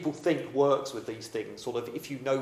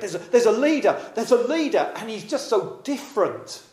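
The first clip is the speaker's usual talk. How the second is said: excited gossip; 8 semitones higher, 5 dB louder than usual. Acted. Crowd gossip about Jesus.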